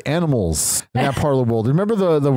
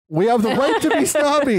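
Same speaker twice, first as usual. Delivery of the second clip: mock outrage; 11 semitones higher than usual. Real, not acted. Joking protest at co-hosts.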